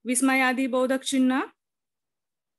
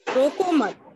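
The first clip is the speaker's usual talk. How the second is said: रोको मत as one higher, louder unit, then stop; pause after मत; 4 semitones higher, 5 dB louder than usual. Shown as a demonstration. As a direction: join रोको to मत and halt after मत. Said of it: "उसके बाद अल्प विराम लगाने से".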